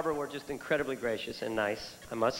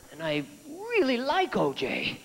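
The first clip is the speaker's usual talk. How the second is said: higher, slow, drawn-out reluctance; mock torn cop; a touch of a fretting cop; reluctant cop; 10 semitones higher, slower than usual. Hesitant cop voice.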